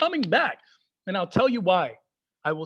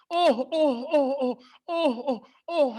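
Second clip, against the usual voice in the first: mock dad groaning; 4 semitones higher, slower than usual. Persian dad laments bad news, hammed-up groaning. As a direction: wail oh oh like a news-watching dad. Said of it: "they love doing this".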